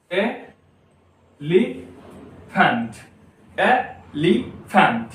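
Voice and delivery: Elephant very slowly, e, li, phant; long pauses inside each elephant; three isolated syllables for elephant. Shown as a demonstration. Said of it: "तो तीन syllable हो गया".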